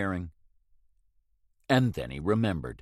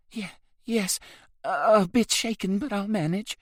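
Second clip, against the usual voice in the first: higher, stammering, a touch shaken; 11 semitones higher than usual. A narrator's reading. Rose answering Hagen after the raid, mildly shaken. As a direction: shaken young woman voice.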